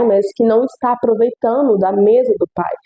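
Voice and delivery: usual speaking voice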